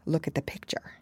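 soft unvoiced storyteller aside; whispered aside. A narrator's reading. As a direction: breathe it to the listener.

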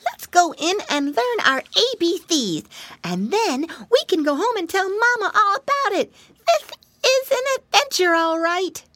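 high, loud piglet; eager piglet ready for fun; excited little pig voice. Acted.

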